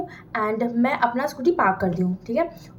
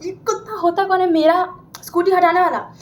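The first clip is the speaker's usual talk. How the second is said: higher-pitched mock fury; play-acted anger; 6 semitones higher than usual. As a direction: fume at the scooter mover, hammed-up. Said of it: "बहुत गुस्सा हो रहा है हमको उस पर".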